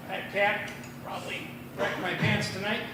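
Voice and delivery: ordinary talk, nothing marked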